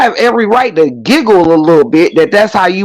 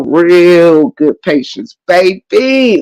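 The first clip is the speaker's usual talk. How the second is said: real good patience, slow and drawn; slow deliberate warning; slow emphasis; much slower than usual. Real, not acted. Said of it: "real good patience repeated".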